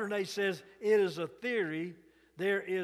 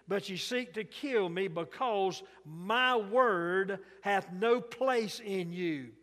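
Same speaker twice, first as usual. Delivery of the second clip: steady, slightly raised pulpit reading; scripture reading; 3 semitones higher, 4 dB louder than usual. Acted. Preacher reads John eight to congregation, declaiming. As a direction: read the Gospel with preacher's force.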